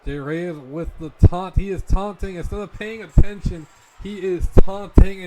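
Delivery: shouting. Acted. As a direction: yell it down at ringside. Commentator shouting at the taunt.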